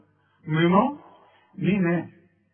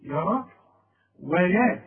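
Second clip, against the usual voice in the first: usual speaking voice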